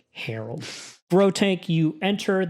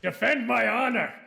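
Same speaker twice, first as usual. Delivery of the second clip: higher, imperious lordly order; Marquis commanding; 8 semitones higher than usual. Acted. Marquis ordering his knight to kill the liar. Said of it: "he says".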